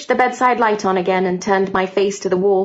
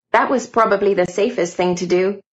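plain reading voice

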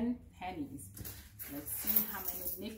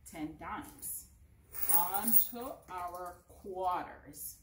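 usual speaking voice

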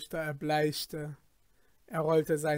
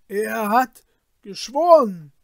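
fearfully; 6 semitones higher, 12 dB louder than usual. Acted. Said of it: "antwortete der Polizeirichter furchtsam".

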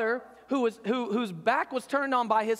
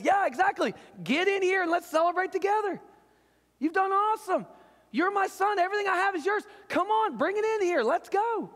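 higher, excited welcoming dad; joyful father; 8 semitones higher than usual. Acted. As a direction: call him in with open delight. Father voiced welcoming older son.

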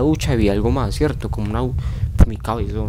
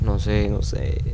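ordinary talk, nothing marked

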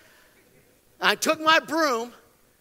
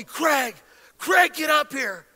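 wife's urgent call, lightly acted; retold calling out; 4 semitones higher, 4 dB louder, slower than usual. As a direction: call upstairs to someone, only mildly raised. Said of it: "my wife calls me".

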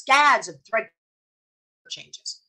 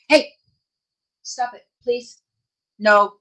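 scolding the dog; 7 dB louder, slower than usual. Real, not acted. Scolding her barking dog.

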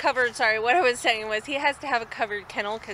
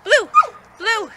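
loud high call; 13 semitones higher, 14 dB louder, slower than usual. Real, not acted. Calling dog loudly high.

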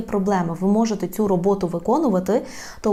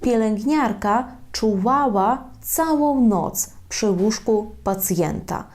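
slow, even dictation pace; deliberate teacher's example; slow model sentence; slower than usual. Shown as a demonstration. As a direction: read it slowly so students can follow. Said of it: "Наприклад".

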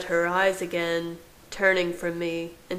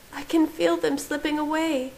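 high, mildly desperate character voice; understated high-pitched desperation; 10 semitones higher than usual. A narrator's reading. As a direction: voice her despair in a much higher pitch. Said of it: "Her voice was desperate".

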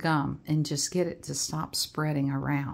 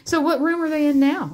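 playful excitement; 9 semitones higher, 7 dB louder, faster than usual. Pretend. Excited reading with child.

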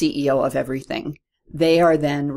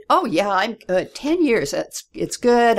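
breezy reassurance; 6 semitones higher, 3 dB louder, slower than usual. Retold or imitated. Her breezy reassurance retold.

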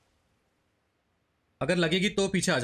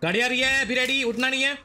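higher, louder hushed urgency; urgent warning; 9 semitones higher, 3 dB louder than usual. Real, not acted. Warning teammates of an approaching car, urgent. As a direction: warn the squad a car is coming.

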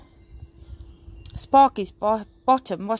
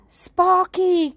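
high, loud scolding call; alarmed cry; 10 semitones higher, 6 dB louder than usual. A narrator's reading. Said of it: "cried George".

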